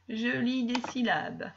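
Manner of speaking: normal talking voice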